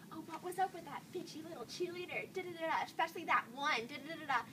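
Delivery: high, hushed mock gossip; snide gossip. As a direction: imitate catty girls muttering behind someone's back. Retells girls' snide gossip.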